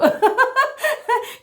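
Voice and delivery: a high laugh that bursts out; high squealing laugh. Real, not acted. Host laughing at her cracking voice, high squeal. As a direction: squeal with laughter at your own voice.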